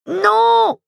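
high piercing scream. Acted. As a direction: scream it at full force. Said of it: "hurla Tidiane".

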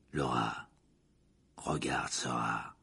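very low, slow, breath-filled rasp; hoarse. A narrator's reading. Prisoner begs captor to look, hoarse. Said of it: "de sa voix rauque".

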